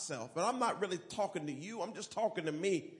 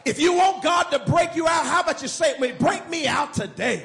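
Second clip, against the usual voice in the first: loud, high preaching cry; call and response shout; 5 semitones higher, 10 dB louder than usual. Real, not acted. Preacher leading the congregation in a shouted chant. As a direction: lead the crowd at full force.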